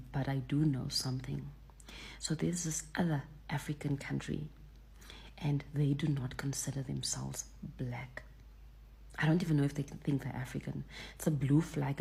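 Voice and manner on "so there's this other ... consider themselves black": gossiping to viewers about another country, whispered. Real, not acted. Gossip aside.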